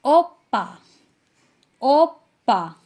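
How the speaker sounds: teacher modelling Korean "ओप्पा"; Korean word. Shown as a demonstration. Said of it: "उसको बोलेगी".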